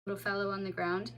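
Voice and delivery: ordinary delivery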